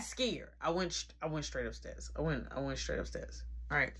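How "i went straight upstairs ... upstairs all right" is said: usual speaking voice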